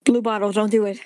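ordinary delivery

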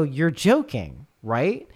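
slow, drawn-out you're-kidding; slow disbelief. Retold or imitated. Noah drawling disbelief at the stranger on the bench. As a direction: stretch it out in disbelief.

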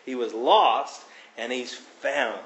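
ordinary delivery

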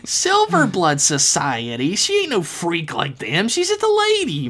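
high-pitched indignant character voice; aggravated snap. Acted. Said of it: "Myrtle snapped back, aggravation in her voice".

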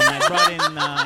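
put-on a ranting Ozzie Guillen accent cracking up. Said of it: "terrible accent".

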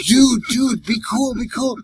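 high, urgent "be cool"; calm him down fast, rattled; panicked pleading. Retold or imitated. Driver frantically pleading with the drunk to calm down.

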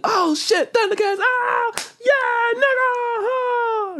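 very high hyped squeals; excited. Retold or imitated. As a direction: scream with childish excitement. Watching Thundercats intro hyped.

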